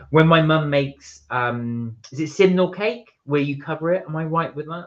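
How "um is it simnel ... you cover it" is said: ordinary talk, nothing marked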